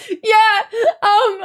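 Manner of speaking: high, teary yeah breaking into a sob-laugh; crying laugh. Retold or imitated. Answering the coworker, crying then laughing. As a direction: let the tears spill into a laugh. Retells crying after the call.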